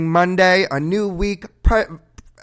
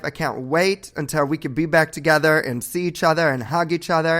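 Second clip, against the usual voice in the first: usual speaking voice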